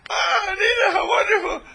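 loud, high, thrilled cry of welcome; overjoyed cry. Retold or imitated. Mother thrilled to hear from Anita, shrieking with joy. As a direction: shriek with delight at the call. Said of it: "And her mother said".

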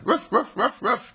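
high, loud yappy ruff ruffs; playful puppy barks. Retold or imitated. Playing with the puppy dog, high mock barking. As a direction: bark like a puppy at play. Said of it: "got down on all fours and went".

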